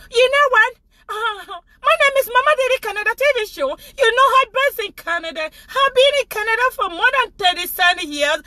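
playing a polished Canadian TV host; mock Canadian English.

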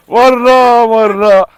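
slow, loud, drawn-out name call; drawn-out loud cheer; cheering the dog. Real, not acted. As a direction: stretch the name out encouragingly.

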